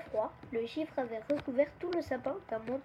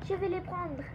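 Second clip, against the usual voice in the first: higher-pitched eager declaration; excited; 5 semitones higher, 4 dB quieter than usual. A narrator's reading. Nina goes to take the stars, excited. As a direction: say it eagerly in a raised voice. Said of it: "s'écria Nina".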